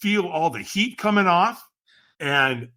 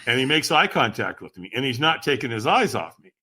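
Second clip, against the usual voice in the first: ordinary talk, nothing marked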